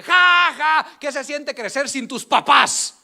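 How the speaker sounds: loud high jeer, slow insult; mocking taunt. Boy taunting the bully back, loud high jeer. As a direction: throw the jab back slowly.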